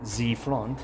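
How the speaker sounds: put-on coming from Z back to Z front in a jokey French accent; hammed-up French accent for a laugh; mock French accent. Gardener joking to his viewers in a mock French accent. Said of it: "That's my French accent here".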